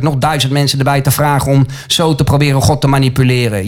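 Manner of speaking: ordinary talk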